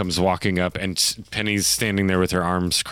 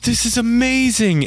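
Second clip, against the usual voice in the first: put-on high, thrilled kid voice; bursting with happiness; boy greeting a returned friend, high and thrilled; overjoyed boy. Tomash overjoyed.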